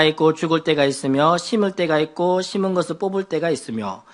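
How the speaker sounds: low, even reading voice; scripture reading. Acted. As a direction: read the verses aloud in a measured low tone. Reads the Bible passage aloud.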